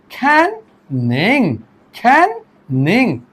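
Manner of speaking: can and नहीं (as "ning") drawn out with a gap between; split into syllables. Shown as a demonstration. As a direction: sound out slowly can... ning, each syllable separate. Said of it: "इसमें दो syllable हैं". (can और निंग को अलग-अलग खींचकर बोलें)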